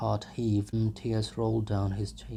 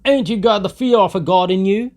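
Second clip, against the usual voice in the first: loud, high, fast reproach; old man shouting at the cruel driver; outraged old man; 11 semitones higher, 15 dB louder, faster than usual. A narrator's reading. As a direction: shame him, shouting. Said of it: "shouted an old man in the crowd".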